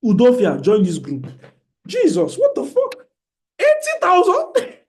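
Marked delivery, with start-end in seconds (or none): 1.9-4.8 shrieking high, laughing in disbelief; shocked disbelief. Real, not acted. Host reacting to a member losing eighty thousand, high shocked shriek. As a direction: gasp at the huge loss, voice shooting up.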